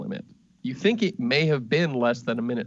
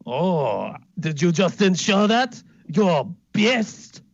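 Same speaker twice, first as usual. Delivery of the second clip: indignant noble; 6 semitones higher, 5 dB louder, slower than usual. Acted. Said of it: "He seems, uh, indignant".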